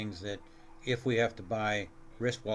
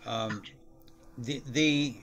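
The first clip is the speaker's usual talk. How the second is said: talking through hiccups; hiccupping between words. Real, not acted. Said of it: "Excuse me, I have the hiccups".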